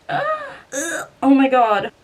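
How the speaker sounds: high, squeaky panicked yelp; panicked cry. Real, not acted. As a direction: let out a real yelp of panic. Panicking while changing earrings.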